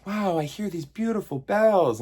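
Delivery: put-on sing-song awe like a small girl; hero girl's voice. Hero girl marveling at the bells, high and awed. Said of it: "Little hero girl's like".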